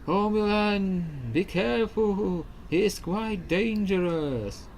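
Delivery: high, slow, worried ghost. Acted. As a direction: warn gently from beyond. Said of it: "This is my ghost voice".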